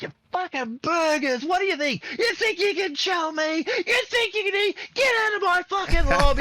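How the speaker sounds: high, loud yelling taunt at the opponent; imitating a pro player yelling at a rival team; yelling esports trash talk. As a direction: scream the taunt across the stage.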